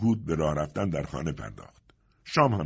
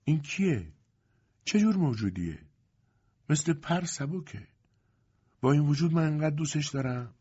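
put-on high, moved young mother; tearful, trembling voice; 8 semitones higher than usual. A narrator's reading. Lipa wonders at her baby, tearfully moved. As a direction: marvel at the baby, close to tears. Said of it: "صدایش میلرزید و اشک در چشمانش حلقه زده بود".

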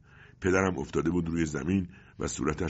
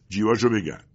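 loud, raised command; barked order; 5 semitones higher, 7 dB louder than usual. Acted. Secret agent orders colleague to search pockets, barked order. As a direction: order a henchman about.

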